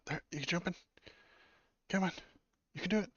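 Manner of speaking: high, eager coaxing voice; high pet coaxing. Real, not acted. Owner urging his dog to grab the broccoli, high coaxing.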